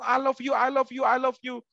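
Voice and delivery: put-on sing-song teacher English as a kid heard it; mock teacher's English. Mimicking the English teacher who always said I love you, in a mild mock accent. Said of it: "Bu Guru tiap hari ngomongnya ngomong".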